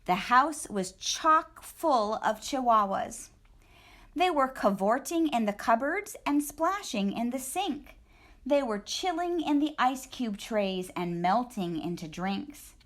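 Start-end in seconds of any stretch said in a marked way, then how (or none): none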